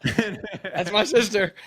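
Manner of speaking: slow, high and laughing. Real, not acted. Quarterback laughing as his sister cries on coach. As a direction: drag it out through a laugh.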